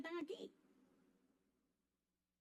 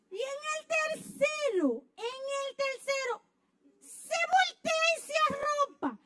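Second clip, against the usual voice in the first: much higher, loud indignant shout; outraged shout; 10 semitones higher, 3 dB louder than usual. Acted. Wife shouting that her husband turned over and slept. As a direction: shout in mock disbelief.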